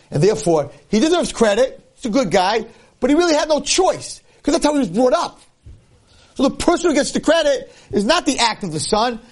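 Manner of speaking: high, forceful sermon delivery; impassioned preaching. Acted. Preaching at raised pitch.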